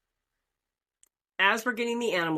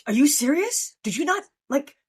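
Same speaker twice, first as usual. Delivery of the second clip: exasperated friends; 8 semitones higher than usual. Retold or imitated. Friends scolding her after the airport, higher exasperated disbelief. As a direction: throw up your hands in disbelief. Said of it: "and they said".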